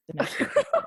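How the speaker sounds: high, laughing through "The next word was hard". Real, not acted. Host cracking up at her own double meaning. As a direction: giggle out the innuendo.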